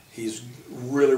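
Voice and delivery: ordinary delivery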